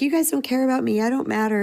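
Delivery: imitating a teen complaining; mock daughter whine. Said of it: "she'd be like".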